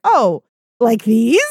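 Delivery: slow, loud teasing "Oh, like these?"; a playful fake-innocent ask; mock innocent question. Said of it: "you were like".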